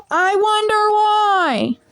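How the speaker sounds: high, slow sarcastic aside; drawn-out mock innocence. Real, not acted. Telling viewers why the teacher was fired, sarcastic.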